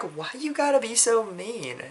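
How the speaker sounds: high-pitched, playful protest; mock hurt complaint. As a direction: plead it high, lightly wounded. Acting out hurt reaction to insult.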